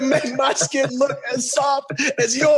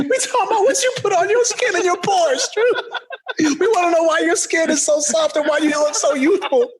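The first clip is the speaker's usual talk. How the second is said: ordinary talk, nothing marked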